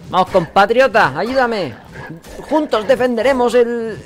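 high, loud hammed-up hero voice; heroic rallying call. Gamer voicing the hero asking his comrade for help, loud and high. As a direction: call your comrade to arms.

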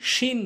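শিন with a short vowel; mispronounced শিন. Shown as a demonstration. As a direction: say শিন briefly, as "shin". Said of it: "ভুল উচ্চারণ".

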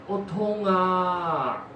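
very slow, stretched-out pained moan. Acted. Injured monk answers the second visitor, very slow moan. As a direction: let the pain drag every word. Sick monk moans pain.

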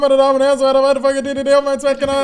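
upbeat channel intro announcer; show host intro. Acted. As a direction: greet the viewers like a show host. Host opens the episode.

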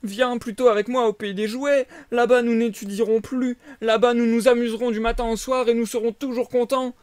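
put-on high, eager boy luring a friend; tempting boy voice. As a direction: coax like a sly schoolmate. (voix de garçon enjôleur)

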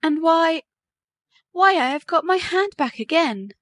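high-pitched, joyful amazement; overjoyed. A narrator's reading. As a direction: exclaim it high, brimming with joy. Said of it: "from sheer joy she burst into tears".

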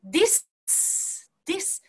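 "this" as "dis" trailing into a hiss; breath passed onward. Shown as a demonstration. Teacher repeating the model, "this" with air flowing out. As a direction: let "this" release freely into s. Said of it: "次に息を送るような感じ".

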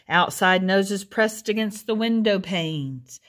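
ordinary delivery

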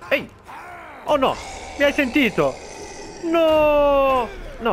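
startled yelling mid-fight; panicked shout. Real, not acted. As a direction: cry out in a sudden fright. Panicked yells at zombie attack.

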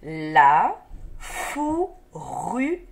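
"La fourrure" split slowly la - fou - rru - re; syllable by syllable. Shown as a demonstration. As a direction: stretch each syllable of "La fourrure" and pause between. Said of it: "des syllabes qui se donnent la main".

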